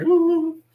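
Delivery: high-pitched, loud "ooh" of anticipation; imitated giddy "ooh"; excited kid squeal. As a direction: squeal like a thrilled child. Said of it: "I'm getting all really excited".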